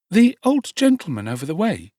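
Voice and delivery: put-on a wondering boy's question; boy asking. A narrator's reading. Oliver questions the Dodger.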